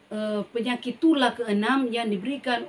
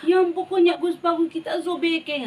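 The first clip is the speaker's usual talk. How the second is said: high, loud sobbing plea to God; crying prayer; 8 semitones higher, 4 dB louder than usual. Acted. King Hizkia weeping as he prays to God, high sobbing plea. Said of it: "Dia menangis adik-adik".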